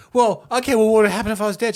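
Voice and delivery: put-on high, loud kid challenge; taunting kid. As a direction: pester mum with a pushy question. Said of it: "the kid was like".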